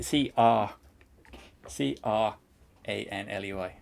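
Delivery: "R" said as a long open "ah"; adapted spelling pronunciation. Shown as a demonstration. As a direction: spell it in pieces, dropping the r sound in "R". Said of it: "But that's it, C".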